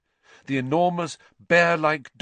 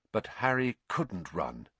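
ordinary delivery

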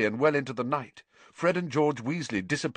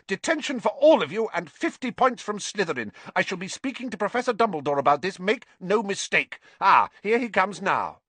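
high, indignant scolding; furious shouting; 9 semitones higher, 4 dB louder than usual. A narrator's reading. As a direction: tell them off in outraged fury. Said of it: "she was shouting".

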